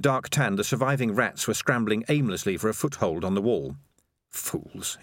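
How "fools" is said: hushed inner voice, much softer; inward thought. A narrator's reading. Dark Tan thinking to himself about the rats, inward and hushed. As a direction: think it aloud under the breath. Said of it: "he thought".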